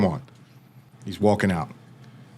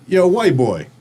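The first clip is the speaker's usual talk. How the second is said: higher, slightly louder call across the cell; 7 semitones higher, 3 dB louder, slower than usual. Retold or imitated. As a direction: grab the new guy's attention. Cellmate calls out to newcomer.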